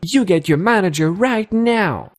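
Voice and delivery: high, bossy mocked Karen; shrill-free high mock order, right now; mock Karen demand. Narrator mocks Karen ordering her to fetch the manager, high and bossy.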